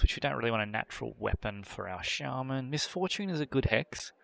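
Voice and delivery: ordinary delivery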